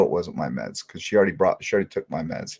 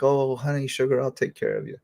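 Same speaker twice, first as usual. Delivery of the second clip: higher, syrupy mock sweetness; lightly voiced nurse, sugary and higher; sweet-talking nurse; 4 semitones higher than usual. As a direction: imitate a sugary nurse cooing. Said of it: "she's like".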